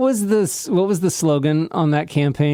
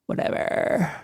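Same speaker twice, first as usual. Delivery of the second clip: vocal fry whisper; 5 semitones higher, 5 dB quieter than usual. Shown as a demonstration. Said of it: "Vocal burn".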